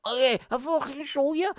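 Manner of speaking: high-pitched mimicked drunk; drunk guy impression. Drunk stranger offering comedian a show, high mimicked voice. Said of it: "He's like".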